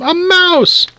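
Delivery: very high, frightened shriek; shouted alarm. A narrator's reading. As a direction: cry out in alarm at the sight. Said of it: "shouted Missus Newcomb".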